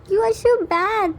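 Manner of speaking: put-on high, whiny crying child; crying hard. A narrator's reading. Little son crying at his appa over a toy, whining. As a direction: wail it out like a sobbing kid. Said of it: "Hobie, while crying hard".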